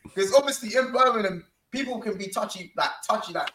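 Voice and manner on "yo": startled shout. Retold or imitated. Man retells being touched on the shoulder, startled shout. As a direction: call out as if suddenly grabbed. Repeats his shouted reaction.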